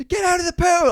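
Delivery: high, loud panicked yell; alarmed parent shouting. Retold or imitated. Parent yelling at swimmers on spotting him in the pool, alarmed shout.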